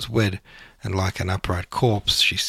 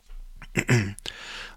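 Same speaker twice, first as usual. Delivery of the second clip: throat clear; 6 dB quieter than usual. Real, not acted. Pause in reading.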